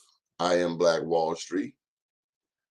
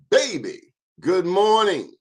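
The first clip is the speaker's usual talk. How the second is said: bright greeting; 10 semitones higher, 4 dB louder than usual. Real, not acted. Host greets viewers on stream.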